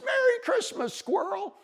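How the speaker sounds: very high little bunny voice; calling back. A narrator's reading. Bunny returns squirrel's greeting, calling back high. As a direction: pipe the greeting up the tree.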